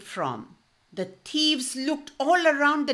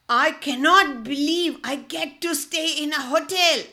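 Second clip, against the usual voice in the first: bright, raised thrilled cry; excited exclaim; 5 semitones higher, 4 dB louder than usual. A narrator's reading. Ali thrilled to stay in a hotel, exclaiming. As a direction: gush with disbelief. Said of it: "exclaimed Ali".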